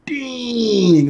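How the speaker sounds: high, drawn-out dang; replaying being floored by a burn; amazed reaction. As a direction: let out a long, impressed high-pitched exclamation. His amazed reaction to a line.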